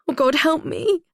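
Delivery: high, desperate begging; desperate plea. Acted. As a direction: beg in panic.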